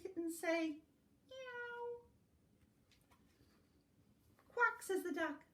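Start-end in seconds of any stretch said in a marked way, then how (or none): 1.3-2.0 very high, soft meow; a tiny kitten's mew. Acted. Kitten sound in picture book. 4.5-4.8 high, loud quack; duck quack. Acted. Duck sound in picture book.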